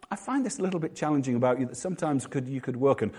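softened rebuke. Shown as a demonstration. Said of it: "He doesn't say".

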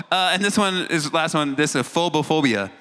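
ordinary delivery

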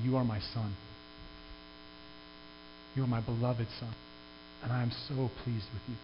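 God softly telling Jesus he is his beloved son. Retold or imitated. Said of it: "the voice that you feel in your chest as it reverberates".

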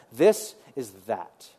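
usual speaking voice

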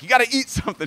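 mock grandma pestering; nagging grandma. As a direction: voice a fussing grandmother urging food. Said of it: "like grandmas are".

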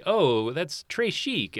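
high, drawn-out precious cartoon girl; Fancy Nancy. Imitating the show's heroine teaching kids vocabulary, affected and drawn out. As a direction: mimic an affected French-loving character, slowly. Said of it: "because she'll say".

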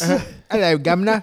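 put-on sloppy British accent, high and loud; mock British accent. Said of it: "I can't do a British accent".

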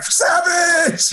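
high, loud mock cry of the name; mock shout. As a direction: yell the name like a hammed-up fan. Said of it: "he's like".

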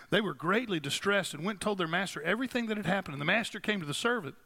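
ordinary talk, nothing marked

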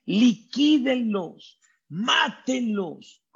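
high, slow yelled commands of the pursuing army; hammed-up shouts of the Egyptian troops; mock battle cry. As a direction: call out the attack like a charging soldier. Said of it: "con los gritos".

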